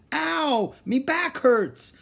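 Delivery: very high, drawn-out wail; pained cry. A narrator's reading. Aching pirate made to dig, high crying. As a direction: cry out slowly in complaint. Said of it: "Stinky cried".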